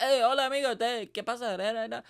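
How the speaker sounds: high-pitched mock Spanish chatter; imitated Spanish speakers. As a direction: parody a lively group of Spaniards. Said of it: "Spanish people speaking Spanish".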